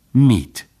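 ordinary delivery

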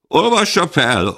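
high, loud call to read it out; demanding shout. Acted. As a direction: demand it from the back of the hall. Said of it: "követelte több hang".

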